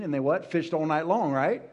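ordinary talk, nothing marked

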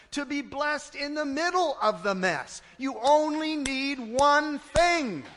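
loud preaching building to a shout. Acted.

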